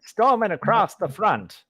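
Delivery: mock old-timey newsreel read with a Transatlantic accent; old newsreel announcer. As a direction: boom it out like a wartime newsreel narrator. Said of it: "Like that kind of thing".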